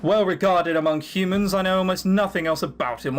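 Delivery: ordinary talk, nothing marked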